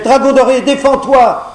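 high, urgent shout; crying out. Acted. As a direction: warn him, loud and high. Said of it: "cria la demoiselle".

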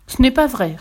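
put-on indignant young prince objecting. A narrator's reading. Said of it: "répondit le prince Blub avec indignation".

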